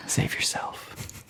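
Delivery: hushed, slow dramatic whisper. Acted. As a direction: whisper it slowly like a warning.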